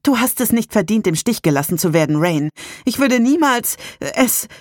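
an angry, tumbling rebuke. Acted. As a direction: push it out fast, furious and hurt. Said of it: "sie war zu verärgert".

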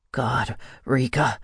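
slow, understated growl. A narrator's reading. Kai to Rika in an intimate moment, growled. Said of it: "Kai growled".

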